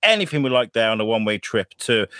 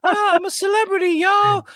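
celebrity impression. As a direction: squeal it like a pop star. Voicing [name].

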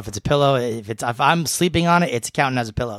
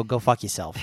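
ordinary talk, nothing marked

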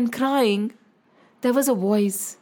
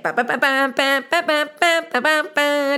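music imitation.

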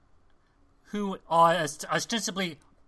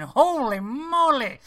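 high, slow, drawn-out holy moly; stunned exclamation; 7 semitones higher, 4 dB louder, much slower than usual. Retold or imitated. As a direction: gasp it out in shock. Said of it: "I remember watching going".

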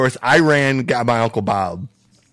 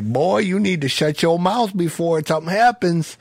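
higher-pitched stern Southern lawman; Southern sheriff; 7 semitones higher than usual. Retold or imitated. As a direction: imitate an old Southern sheriff giving a warning. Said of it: "the typical old Southern sheriff".

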